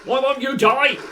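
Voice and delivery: high loud frustrated yell; frustrated shout. Real, not acted. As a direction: yell at the unkillable enemy. Enemy won't die.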